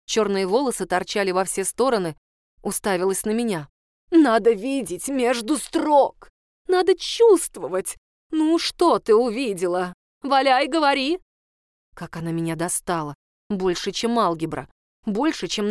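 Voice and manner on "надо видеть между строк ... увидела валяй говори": high, loud, slowed exhortation; passionate rant. Acted. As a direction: urge her to feel it. Teacher ranting. (страстно и громко)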